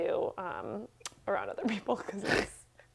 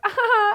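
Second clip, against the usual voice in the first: mock scream; 12 semitones higher, 10 dB louder than usual. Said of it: "Blood-curdling scream".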